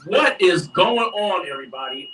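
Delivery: radio host intro. Shown as a demonstration. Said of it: "let me try that".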